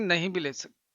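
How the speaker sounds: normal talking voice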